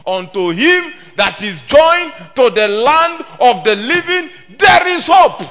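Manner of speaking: preaching that swells to a ringing high peak; preaching climax. Acted. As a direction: climb to a shout on hope. Building scripture quote to climax.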